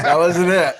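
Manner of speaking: loud words through a laugh; laughing protest. Real, not acted. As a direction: laugh it out while objecting. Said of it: "No, no, no".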